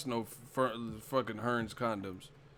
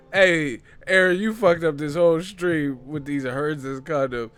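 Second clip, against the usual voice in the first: loud, raised voice cracking up; laughing outburst; 5 semitones higher, 10 dB louder than usual. Real, not acted. Streamer blaming a viewer for wrecking the stream, loud laughing outburst. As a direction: shout it out through real laughter. Loses it, blames viewer Aaron.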